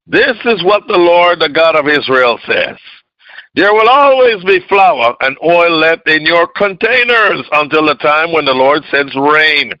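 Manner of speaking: high, loud preaching voice. Acted. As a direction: proclaim the scripture high and loud.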